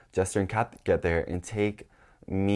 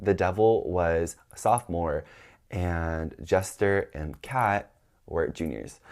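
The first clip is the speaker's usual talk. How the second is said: normal talking voice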